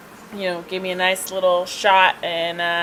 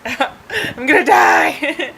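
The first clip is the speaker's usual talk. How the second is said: higher, slow drawn-out wail; hammed-up cry of doom; mock freaking out; 6 semitones higher, much slower than usual. Driver on ambulance steps, wailing in mock panic. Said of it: "I was still freaking out".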